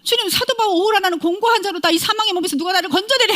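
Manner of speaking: a high, impassioned echo of Paul crying out; apostle Paul's lament. Retold or imitated. Preacher voicing Paul's anguished cry to the congregation, high and impassioned. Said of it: "사도 바울 이랬잖아요".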